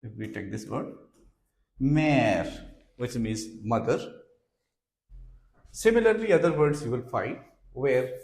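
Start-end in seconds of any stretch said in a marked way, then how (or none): 1.8-2.5 "mère" with a long open "eh"; prolonged E. Shown as a demonstration. Teacher gives French for mother, "mère" stretched out. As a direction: hold the vowel in "mère" before the R. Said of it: "It prolongs the pronunciation".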